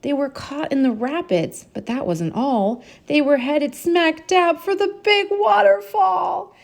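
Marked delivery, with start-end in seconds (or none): 3.1-6.6 high, urgent narration; rising alarm toward the falls. A narrator's reading. Narrator reads the danger to the child, high and urgent.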